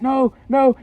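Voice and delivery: high, loud cry of protest; re-enacted desperate shouting; acted panicked yell. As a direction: call out as if chasing a runaway vehicle. Acts out chasing the bus.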